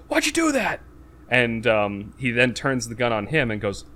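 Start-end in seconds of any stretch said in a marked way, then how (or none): 0.1-0.8 very high-pitched incredulous question; mock shocked protest. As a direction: squeal it like an imitation of outrage. Nick Cage protests soldier being shot.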